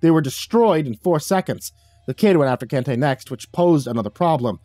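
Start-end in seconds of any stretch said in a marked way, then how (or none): none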